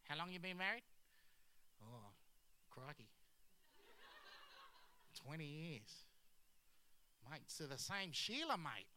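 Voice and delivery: hammed-up Aussie mate chat; Australian accent. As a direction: play astonished Australians trading questions in thick accents. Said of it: "that's the only Australian accent".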